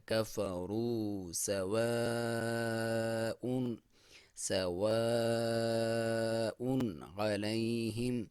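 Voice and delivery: سواء held very long; slow recitation with madd. Shown as a demonstration. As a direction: stretch سواء with rounded lips. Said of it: "واو کو ہونٹ گول کر کے پڑھنا ہے".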